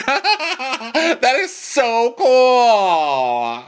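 very high, loud, long-drawn gush; hammed-up fangirl swoon; mock awestruck squeal. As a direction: squeal it and stretch the last word. Mimicking Casey's gushing.

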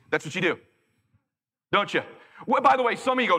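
normal talking voice

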